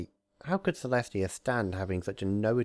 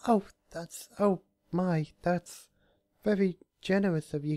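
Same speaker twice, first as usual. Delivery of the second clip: high, hesitant and halting; timid high stammer; uncertain Fluttershy; 11 semitones higher than usual. A narrator's reading. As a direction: stammer politely, unsure. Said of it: "she replied uncertainly".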